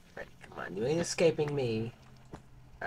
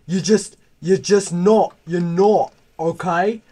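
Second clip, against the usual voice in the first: mock British lad picking a fight, high and loud; loud mock British pub-brawl challenge; mock British shouting; 4 semitones higher, 11 dB louder than usual. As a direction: bellow like a rowdy British yob, "mate!". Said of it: "I'm British".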